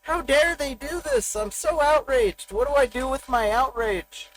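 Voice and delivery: hammed-up high indignant huff, slowed at first; mock outrage. Mocking offended viewers of explicit content, hammed-up high indignation. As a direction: parody a scandalized viewer. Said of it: "Maybe you're like".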